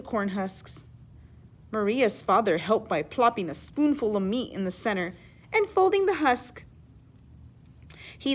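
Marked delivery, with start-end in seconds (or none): none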